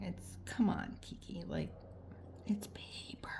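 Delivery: breathy whispered self-scolding. Real, not acted. Crafter scolding herself over paper, whispered. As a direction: hiss it at yourself, half amused. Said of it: "scolding herself under her breath".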